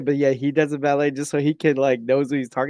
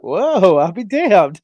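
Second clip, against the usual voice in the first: higher, louder retold astonishment; acted amazement; 5 semitones higher, 4 dB louder, much slower than usual. Telling how he got the Spock reference, acted amazement. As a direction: replay being impressed, pushed up and loud. Acting out his amazed reaction.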